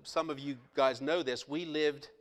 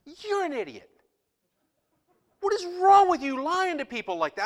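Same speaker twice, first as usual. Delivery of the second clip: high, loud indignant outburst; high loud indignant scolding; angry retort; 12 semitones higher, 8 dB louder than usual. Retold or imitated. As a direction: scold the lying preacher. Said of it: "I'm like".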